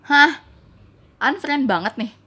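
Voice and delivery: loud, high startled huh; retold disbelief. Hearing he wants to unfriend her, shocked. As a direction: burst out in mock shock.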